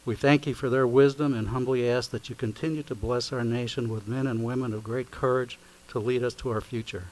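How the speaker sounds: usual speaking voice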